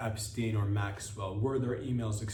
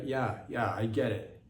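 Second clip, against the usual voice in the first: usual speaking voice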